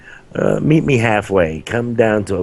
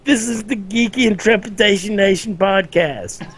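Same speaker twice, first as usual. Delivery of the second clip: high-pitched put-on objection; high voice reining in the hosts; mock protest; 13 semitones higher than usual. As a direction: squeak out a comic protest, way up high. Mock protest at politics topic.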